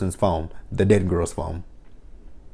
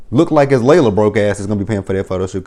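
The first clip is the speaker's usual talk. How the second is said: ordinary talk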